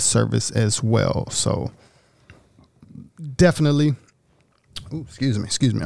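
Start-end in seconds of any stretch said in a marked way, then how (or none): 4.9-5.8 mid-sip, swallowing between words; while sipping a drink. Real, not acted. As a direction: talk around a sip of drink. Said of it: "I'm drinking at the same time".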